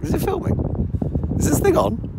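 booming, camp diva greeting; big theatrical drag queen hello; campy drag queen. Pretend. Loud camp greeting to the camera.

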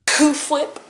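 "Whip" said as "hwip", with a puff of air; exaggerated H in Whip. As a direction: overdo the H in "Whip". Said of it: "so much emphasis on the H".

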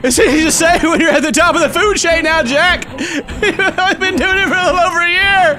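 hammed-up tearful outburst; mock crying rant. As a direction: whine at Jack like a jealous rival. Mock-jealous rant at Jack.

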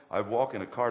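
normal talking voice

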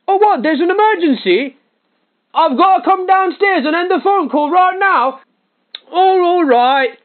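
mock shock at a family emergency; fake alarm. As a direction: shout it toward another room. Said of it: "It'd go a little something like this".

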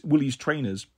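plain reading voice

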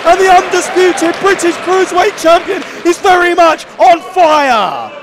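loud, rising wrestling call; excited commentary. Real, not acted. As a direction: sell the high-flying moment. Live commentary on a big dive.

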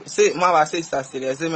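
ordinary talk, nothing marked

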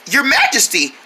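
ordinary talk

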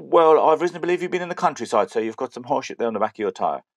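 bad English policeman accent, starting high and loud; mock English copper laying out his evidence; put-on English accent. Guard accuses driver of breaking limit, bad English accent. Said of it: "the shit English accent".

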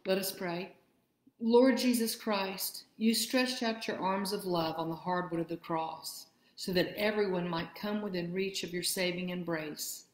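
usual speaking voice

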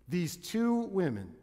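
normal talking voice

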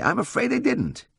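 high-pitched regretful admission; mildly sad. A narrator's reading. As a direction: break the bad news ruefully. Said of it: "he said sadly".

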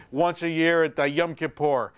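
ordinary talk

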